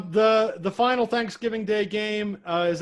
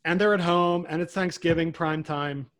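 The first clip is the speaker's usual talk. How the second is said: ordinary talk, nothing marked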